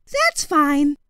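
lightly voiced young boy; boy's voice. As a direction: agree pleasantly, voiced high.

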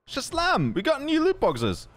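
high, excited cheer over new loot boxes. Real, not acted.

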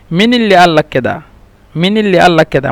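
Egyptian "আল", q turned to a glottal stop; Egyptian dialect. Shown as a demonstration. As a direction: join "লাক্কেদা" as one colloquial word. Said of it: "কফকে মিশরিরা হামজা বলে".

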